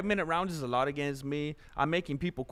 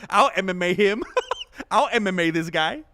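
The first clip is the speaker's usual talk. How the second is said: amused loud repeat; 4 semitones higher, 9 dB louder than usual. Retold or imitated. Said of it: "I never heard it used like that".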